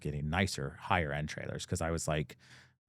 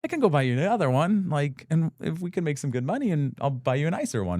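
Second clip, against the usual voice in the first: higher, louder, faster eager pleading; eager pitch; 8 semitones higher, 4 dB louder than usual. Retold or imitated. Son coaxing his mom into selling her new trailer, eager and fast. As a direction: talk her into it, quick and keen. Persuading mom to let him sell.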